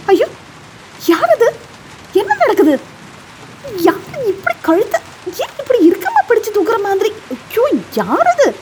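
high, startled squawking hen voice; a hen in sudden alarm, voice pitched high; panicked hen cackle. A narrator's reading. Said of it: "திடீரென கொக்கரித்தாள்". (பதறிய கோழியின் உயர்ந்த குரல்)

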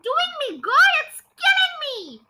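high-pitched outraged shriek; screaming. A narrator's reading. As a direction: screech in protest, swooping high. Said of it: "she screamed".

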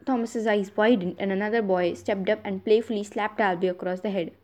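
ordinary delivery, nothing marked

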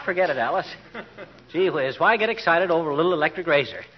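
normal talking voice

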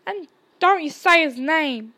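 loud tearful plea. A narrator's reading. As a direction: beg through tears. Hagrid pleading while crying.

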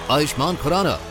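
ordinary talk

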